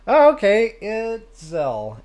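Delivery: ordinary talk, nothing marked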